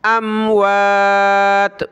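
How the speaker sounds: अमवात stretched very slowly; slow tajweed recitation of अमवात; drawn out. Shown as a demonstration. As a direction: hold each vowel of अमवात long. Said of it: "यहाँ हमने सबीलिल्लाह नहीं पढ़ना".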